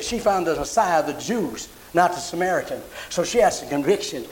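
usual speaking voice

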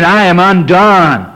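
slow, drawn-out lament; crying out in repentance. Retold or imitated. Preacher voicing a repentant sinner, slow lament. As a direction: plead it slowly like a sinner's cry. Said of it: "We'd be saying".

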